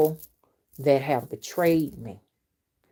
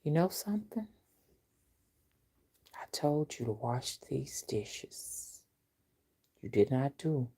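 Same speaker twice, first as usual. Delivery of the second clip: lowered menacing voice; 7 dB quieter than usual. Retold or imitated. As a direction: drop to a quiet threat like an angry mom. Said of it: "she lowered her voice like that".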